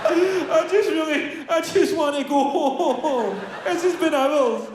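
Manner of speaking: put-on a kid near tears begging; whiny crying kid.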